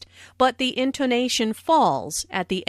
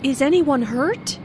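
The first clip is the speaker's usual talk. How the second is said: anxious, rising question; worried question; 5 semitones higher than usual. Acted. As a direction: ask it fearing someone is hurt. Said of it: "asked in a worried way".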